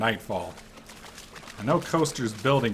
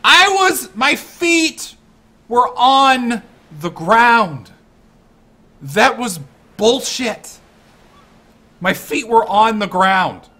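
higher, louder, slow outraged protest; indignant rant; 10 semitones higher, 8 dB louder, slower than usual. Real, not acted. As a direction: drag each word in disbelief. Ranting about unfair death.

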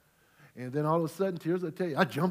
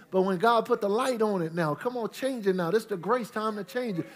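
ordinary talk, nothing marked

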